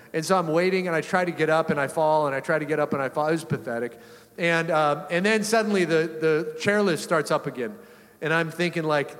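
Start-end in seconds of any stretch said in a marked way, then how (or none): none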